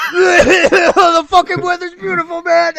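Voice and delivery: high, loud, slow, laughing through a quote; cracking up while quoting a comedian's line; squealing with laughter while imitating the skit; laughing impression. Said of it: "and he's like".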